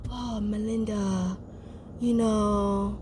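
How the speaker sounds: slow, low, faint retold voice; imitated passed-out mumbling. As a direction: drag it out low and soft, barely awake. Voicing passed-out Harley addressing Melinda.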